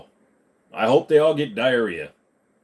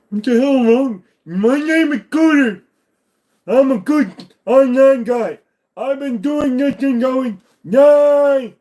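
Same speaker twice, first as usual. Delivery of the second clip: Cooter voice; 12 semitones higher, 7 dB louder, slower than usual. Pretend. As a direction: play a dopey, loud online guy named Cooter. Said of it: "when I do that, it's like".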